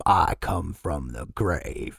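low, slow, soft voice from the grave; creepy slow old-man impression; old man's voice. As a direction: imitate a dead old man, drawing it out. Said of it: "the old man's voice comes out".

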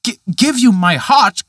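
put-on a young man aghast at the bargain; horrified cry. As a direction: recoil in horror, tripping on the first word. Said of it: "cried Peter, quite horrified".

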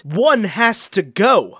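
slowly, weighing each word; mock-solemn announcement; slow dramatic read. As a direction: read it out like a grim verdict. Reading the meme caption aloud.

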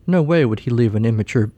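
plain reading voice

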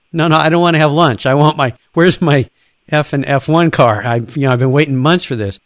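frustrated protest. Retold or imitated. Friend refusing lunch at the factory, loud and exasperated. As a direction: push back hard, fed up.

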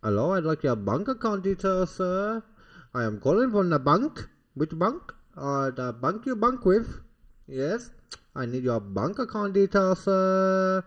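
mock Nigerian phone scammer; hammed-up Nigerian accent, drawing out the ends. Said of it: "Nigerian accent".

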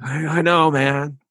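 put-on sheepish New York accent. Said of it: "New York accent".

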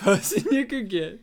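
normal talking voice

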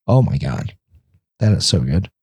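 normal talking voice

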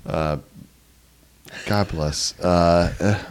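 talking through hiccups; words broken by hiccups. Real, not acted. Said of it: "hiccuping right now".

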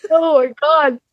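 high, laughing through tears; helpless laughter over the word; crying with laughter. Real, not acted. Laughing to tears at her failed Russian accent. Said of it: "now I'm crying".

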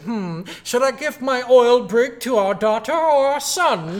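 slow, high, loud pompous drawl; hammed-up snob pondering his fortune; pompous rich man. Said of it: "like, all pompous and shit".

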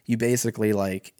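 usual speaking voice

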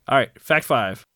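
ordinary talk, nothing marked

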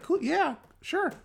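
very high, squeaky put-on voice; eager youngster. Young worker agrees to see cool stuff, eager youngster. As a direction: caricature an agreeable kid in falsetto.

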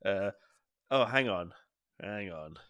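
usual speaking voice

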